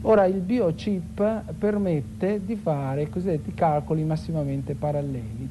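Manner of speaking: usual speaking voice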